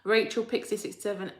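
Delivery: ordinary delivery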